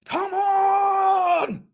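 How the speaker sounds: long, high, pained shout; anguished cry. Real, not acted. Fan crying out at the screen, anguished shout. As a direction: cry it out in despair.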